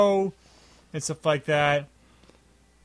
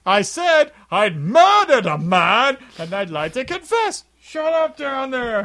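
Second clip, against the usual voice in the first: impatient loud repeat; 8 semitones higher, 4 dB louder, slower than usual. Retold or imitated. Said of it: "the movie character".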